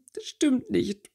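hoch und verweint herausgepresst; schluchzend. Acted. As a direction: unter Tränen widersprechen. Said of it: "schluchzte Winona". (Winona widerspricht der Freundin schluchzend, mit viel höherer Stimme)

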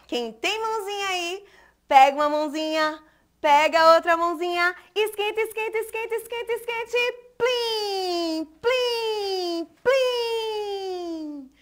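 high sing-song magic chant; magic spell. Acted. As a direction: cast the spell playfully. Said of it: "uma mágica eu vou fazer".

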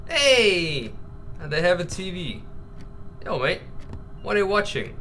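usual speaking voice

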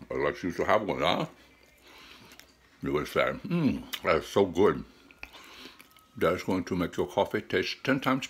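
talking between bites; chewing while talking. Real, not acted. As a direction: mouth partly full of candy. Seen 1: Bites candy at -1, mouth works food while talking, candy held.

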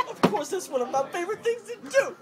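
high, loud laughing voice; reading through giggles. Real, not acted.

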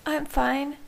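high, understated brave front; a touch of upset, pitched well up; voice breaking slightly. A narrator's reading. Bella insists she's okay to Jacob, voice breaking slightly. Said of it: "her voice breaking slightly".

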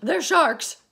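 high, loud panicked cry; frightened cry. A narrator's reading. Earthworm crying out to the others in fear. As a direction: blurt it out in terror. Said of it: "cried the earthworm".

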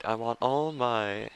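slow, loud attempted Italian accent; bad Italian accent. Gamer voicing a boss calling his thugs, bad Italian accent. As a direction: try a mock Italian boss, drawn out. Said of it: "I can't do an Italian accent".

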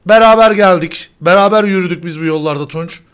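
loud, hammed-up loyalty; mock-solemn declaration. Host hamming up loyalty to his teammate. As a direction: proclaim it like a mock oath.

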